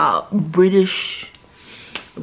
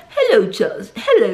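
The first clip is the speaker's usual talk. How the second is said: mock British greeting; put-on British soap lady hello; Hyacinth; 3 semitones higher than usual. Hyacinth greeting Charles in the kitchen, mock British accent. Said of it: "And so Hyacinth's like".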